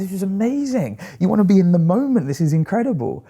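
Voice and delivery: retelling a swim among whales, gushing; awestruck. Retold or imitated. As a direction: gush like it's the best moment ever. Awestruck reaction to seeing whales.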